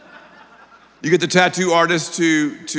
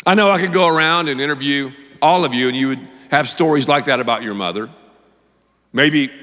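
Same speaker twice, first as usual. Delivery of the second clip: ordinary delivery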